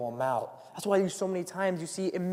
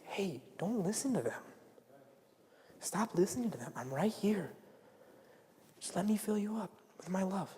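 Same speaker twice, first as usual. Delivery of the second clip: softer, gentle reassurance; comforting whisper; 9 dB quieter than usual. Retold or imitated. Preacher voicing God comforting a rejected teen, softly. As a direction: hush them tenderly like God beside you.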